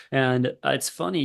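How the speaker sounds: usual speaking voice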